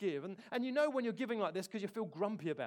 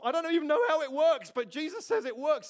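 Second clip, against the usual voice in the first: loud and high, urgent; emphatic preaching; 8 semitones higher, 10 dB louder than usual. Real, not acted. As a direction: push it up with conviction.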